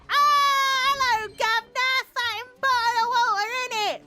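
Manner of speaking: high, loud mock London Cockney; hammed-up Cockney geezer; Cockney accent. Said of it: "the Cockney accent".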